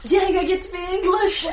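high, loud burst of delight; excited. Real, not acted. Player learns they get the English character, excited. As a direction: exclaim it with a laugh in the voice.

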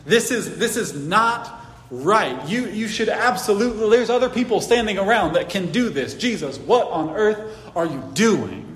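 imitating an indignant Peter. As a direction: voice Peter's shocked objection to his master. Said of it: "can you hear the indignance in Peter's voice".

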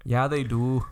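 put-on Michigan accent. Shown as a demonstration. Insisting to co-host they talk like this, Michigan accent. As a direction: argue back in mock Michigan vowels. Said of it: "They talk like this in Michigan".

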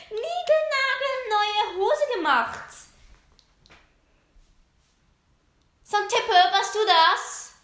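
a hint of a high diva voice, slowed. Diva scolding a classmate over her new trousers. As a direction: drag out the indignant question. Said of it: "altbekannte Zicke".

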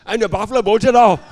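loud, high comic climax; shouted punchline. A narrator's reading. Pastor ending the buffalo joke for congregation, shouted punchline. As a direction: belt out the story's final payoff.

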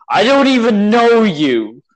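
high, loud imitation of a cartoon rage; imitating someone suddenly losing it; mock angry outburst. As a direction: blow up in hammed-up anger. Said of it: "he gets really angry".

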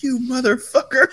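high, half-laughing mock anger; mock mad. Friend retells scolding the impostor, high mock anger. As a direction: curse him out in playful mock fury. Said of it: "You were mad".

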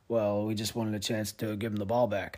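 low flat coach's mumble to the press; Belichick impression. Podcaster imitating the coach for a reporter, low and muted. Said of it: "I can't do a Belichick at all".

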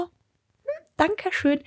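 flattered reply to a date's compliment; coy flattered thanks. Retold or imitated. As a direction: melt a bit as you thank him.

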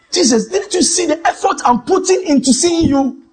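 high, pleading cry to Jesus; frustrated plea. Retold or imitated. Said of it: "I felt like".

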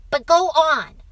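put-on high, loud child voice; urging boy. A narrator's reading. Boy prods father onward, urging. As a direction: push him to continue.

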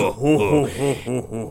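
booming slug-alien belly laugh; Jabba the Hutt. Retold or imitated. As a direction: do a mock villainous ho-ho-ho. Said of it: "He sounds like Jabba the fucking Hutt".

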